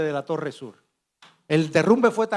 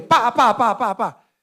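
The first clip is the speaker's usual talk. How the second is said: imitated crash sound; 6 semitones higher, 6 dB louder, slower than usual. Imitating the building collapsing.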